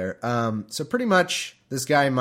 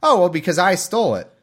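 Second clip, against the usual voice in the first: higher, louder offhand confession; mock casual thief; 7 semitones higher, 7 dB louder than usual. Host voicing Micah admitting the theft, higher and offhand. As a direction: toss off the theft like nothing. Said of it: "And he says".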